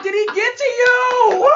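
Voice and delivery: high, loud, slow laughing disbelief; shouted mock disbelief; incredulous. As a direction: ask it as if he's lost his mind. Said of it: "I'm like".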